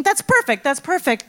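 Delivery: high, excited self-congratulation; delighted with her own lie; gleeful scheming. Retold or imitated.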